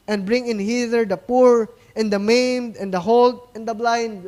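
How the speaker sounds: booming Bible reading from the pulpit; scripture reading. Acted. Reads scripture aloud.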